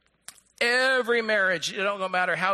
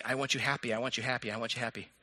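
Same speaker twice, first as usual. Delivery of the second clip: low, hushed repetition, fading; soft imagined refrain; 7 semitones lower, 6 dB quieter, faster than usual. Acted. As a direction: murmur it like a dismissed mantra. Voices God, repeated soft refrain.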